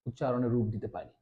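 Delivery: usual speaking voice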